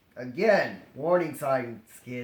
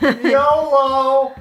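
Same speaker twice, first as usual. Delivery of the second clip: shouted joke while laughing; 9 semitones higher, 12 dB louder than usual. Pretend. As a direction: yell it out, laughing. Joking exclamation, laughing.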